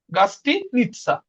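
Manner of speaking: গাস্তিনিৎসা slowly, with a break before "nitsa"; slow syllable-split demo. Shown as a demonstration. Teacher repeating the word for hotel to learners, গাস্তিনিৎসা slow and split.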